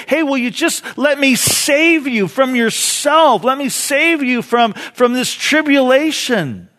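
high, loud, urgent appeal; impassioned plea. Acted. Jesus appealing to the already saved, crying out. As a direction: cry out to be allowed to help. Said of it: "for those of you who are already saved".

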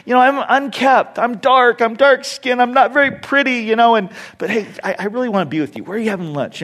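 lightly voiced wife, self-conscious and eager; insecure wife. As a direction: play the shy wife begging not to be seen. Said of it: "she's like".

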